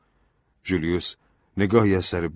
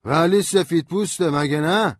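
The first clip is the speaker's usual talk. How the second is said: much higher, louder suspicious demand; high, loud drunk voice; hostile drunk; 11 semitones higher, 5 dB louder than usual. A narrator's reading. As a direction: eye the stranger with distrust. Drunk lawyer objecting.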